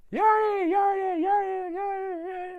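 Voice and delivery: high, loud shout repeated; calling out. Retold or imitated. The Grinch shouts a name into the echoing cave. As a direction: yell the name into the void.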